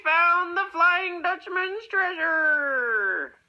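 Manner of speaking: triumphant pirate shout. Acted. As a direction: shout it, treasure found. Pirates find the treasure.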